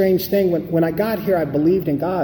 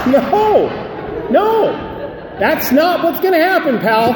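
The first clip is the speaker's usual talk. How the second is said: emphatic shout; 8 semitones higher than usual. Acted. Sponsor rejecting a newcomer's urge to drink, shouting no. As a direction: shut the idea down hard, pal.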